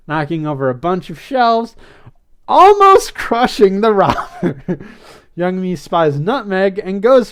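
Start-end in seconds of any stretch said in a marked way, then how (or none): 2.5-4.9 louder, higher, breaking into laughter; laughing. Real, not acted. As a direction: let real laughter break through the words. Amused by the recapped mishap.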